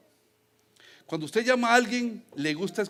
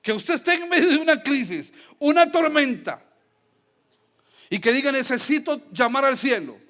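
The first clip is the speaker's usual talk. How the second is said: excited; 6 semitones higher, 8 dB louder than usual. Real, not acted. Preacher exclaims laughing.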